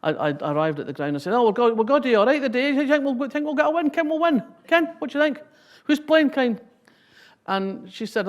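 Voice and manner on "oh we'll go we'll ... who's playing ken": high, loud broad Scottish banter; broad Scots. Retold or imitated. Arriving at the match with her partner, broad Scots. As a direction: rattle it off in thick Scots at the football. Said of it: "it slips a bit".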